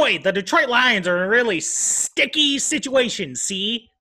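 put-on hammy old radio narrator voice; old-timey radio narrator. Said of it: "in an old timey fifty radio's narrator voice".

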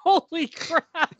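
high, drawn-out gasp of disbelief; a stunned, slow cry of shock. Retold or imitated. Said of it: "I was like".

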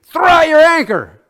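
high, loud shout over the waves. Retold or imitated. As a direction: call it out across the surf. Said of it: "I heard him shout to me".

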